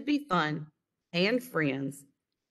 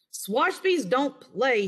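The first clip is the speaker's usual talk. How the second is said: slow, louder grumpy sailor voice; gruff refusal; 6 semitones higher, 3 dB louder, slower than usual. A narrator's reading. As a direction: refuse like a stubborn old man. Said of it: "he answered".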